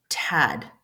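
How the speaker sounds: "tad" whispered, unvoiced t. Shown as a demonstration. Teacher contrasting tad with voiced dad. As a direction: breathe "tad" with no voice. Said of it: "when we're not".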